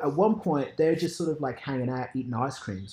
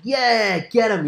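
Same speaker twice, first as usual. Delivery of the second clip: cheering; 8 semitones higher, 6 dB louder, much slower than usual. Retold or imitated. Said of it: "and I was like".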